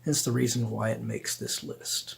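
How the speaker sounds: usual speaking voice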